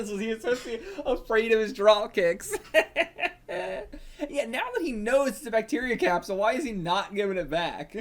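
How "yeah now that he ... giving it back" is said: ordinary talk, nothing marked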